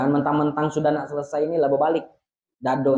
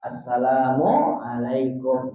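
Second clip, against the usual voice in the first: Assalamualaikum said slowly in one unbroken breath; slow connected demo; 5 semitones lower, much slower than usual. Shown as a demonstration. Teacher shows joined greeting, slow and unbroken. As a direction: join Assalamualaikum smoothly, no gap. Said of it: "Muwalah langsung".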